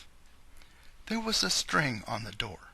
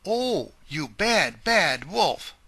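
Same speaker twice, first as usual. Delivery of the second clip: slow, louder outcry at the wolf; crying out; 6 semitones higher, 9 dB louder, slower than usual. Acted. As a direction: scold the wolf loudly and slowly. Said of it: "cried the grandmother".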